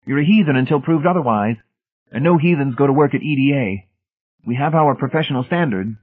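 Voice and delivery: high, insistent zealot voice; inexorable. A narrator's reading. Brady rejecting the unqualified outsider, inexorable. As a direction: lay down the rules, pitched well up. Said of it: "Brady continued inexorably".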